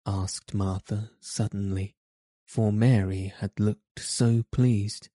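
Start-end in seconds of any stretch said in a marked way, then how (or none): none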